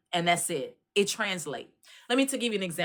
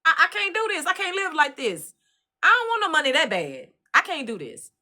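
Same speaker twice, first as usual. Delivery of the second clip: high, pleading distressed protest; overwhelmed; 8 semitones higher, 3 dB louder than usual. Retold or imitated. Aide telling herself she cannot work there, high distressed. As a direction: refuse it with rising despair. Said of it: "I said".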